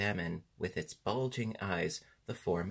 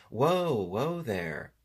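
loud, drawn-out, higher call; 4 semitones higher, 6 dB louder, much slower than usual. A narrator's reading. Tip tries to halt the prancing Sawhorse, calling out. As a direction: call out slowly to halt a runaway horse. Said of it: "so he called out".

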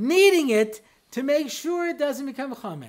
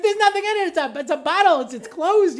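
raised, higher voice in an argument; exasperated husband pleading his case, louder and higher; heated protest; 7 semitones higher, 5 dB louder than usual. Retold or imitated. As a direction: insist loudly that it's only a closed bottle. Arguing with wife over wine.

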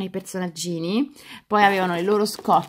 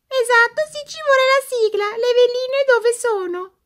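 high, chirpy toy doll voice; squeaky doll voice; 5 dB louder than usual. Acted. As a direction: play a cheeky little doll.

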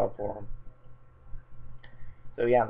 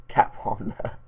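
laughing; 3 semitones lower, slower than usual. Shown as a demonstration. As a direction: giggle while rereading "Catwomna". Rereads misspelling, laughing.

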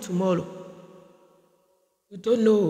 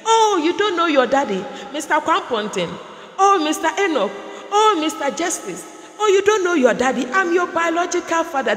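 pleading father; 5 semitones higher than usual. Retold or imitated. Old father on loudspeaker pleading with his rich son, half laughing. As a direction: beg him to recognize you, with a nervous laugh.

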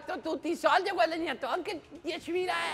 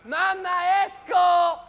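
louder shouted call to mum; 6 dB louder, slower than usual. Acted. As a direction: yell it from the doorway.

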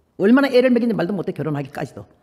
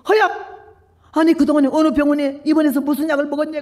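sudden high cry, then urgent questions; astonished nun; 6 semitones higher, 3 dB louder than usual. Retold or imitated. Nun stunned by the healed X-ray, crying out high. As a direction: gasp out her name in disbelief.

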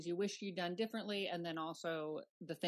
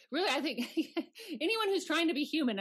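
laughing through it, higher and louder; 9 semitones higher, 7 dB louder, faster than usual. Real, not acted. Host laughing while praising the book, higher and louder. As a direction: let the laugh spill into the words.

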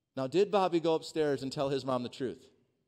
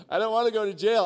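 put-on scared kid; 8 semitones higher, 11 dB louder, faster than usual. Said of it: "I'm like".

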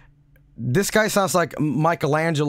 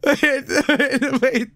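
laughing; 6 semitones higher, slower than usual. Real, not acted. Laughing too hard to speak.

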